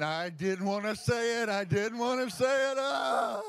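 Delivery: high, loud anguished wail; crying out. Retold or imitated. The weeping woman crying out to the speaker, high and loud. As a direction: wail it out through tears. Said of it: "And I'm saying".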